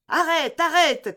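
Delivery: high, loud cry to halt; urgent shout. A narrator's reading. D'Artagnan releasing his furious horse, shouting out. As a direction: call out sharply at a high pitch. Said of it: "cria D'Artagnan".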